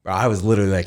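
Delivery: ordinary delivery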